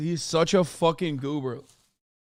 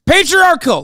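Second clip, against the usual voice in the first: Patriarchal! yelled much higher and louder, every syllable hammered; shouted word; 11 semitones higher, 9 dB louder than usual. Shown as a demonstration. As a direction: scream Patriarchal! to prove you can say it. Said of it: "Is that good? Did I say it right?".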